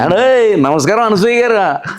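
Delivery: high, drawn-out Godavari hello; Godavari slang greeting. Retold or imitated. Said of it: "first intro అది". (గోదావరి యాసలో పలకరింపు)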